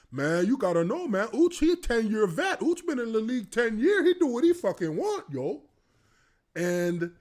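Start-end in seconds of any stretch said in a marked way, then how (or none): 0.1-5.6 louder, higher easygoing defense; laid-back lineman. Retold or imitated. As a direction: vouch for him, loose. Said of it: "Chris Naole says".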